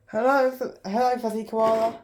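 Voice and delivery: loud, high pretend greeting; saluto per gioco. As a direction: call out lightly in play to a toy. Pretend play greeting a toy.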